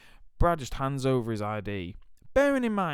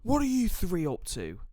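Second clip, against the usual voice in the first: high, louder stern teacher's challenge; 9 semitones higher, 5 dB louder than usual. Retold or imitated. Deputy head confronting three boys, high loud stern challenge. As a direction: bark it at all three kids. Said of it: "he goes".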